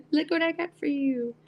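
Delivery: put-on high, eager little kid showing off to mom; a lightly voiced eager child; proud kid voice. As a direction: play the Knight as a proud child with a gift, pitched high. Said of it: "He's like".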